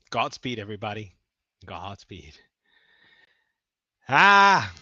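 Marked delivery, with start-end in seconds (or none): 4.1-4.7 sudden loud yell; shouting. Real, not acted. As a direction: burst out with a yell. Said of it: "Sorry for the shouting".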